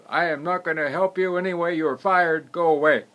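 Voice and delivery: loud, high, angry outburst. Acted. Wealthy man firing the gardener who took his hat, angry. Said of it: "absolutely angry".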